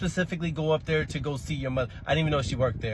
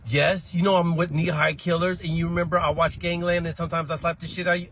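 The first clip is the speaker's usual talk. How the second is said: put-on higher, louder boastful child voice; cocky kid; 6 semitones higher, 5 dB louder than usual. Boastful kid claiming to be a gangster. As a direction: brag like a tough little gangster.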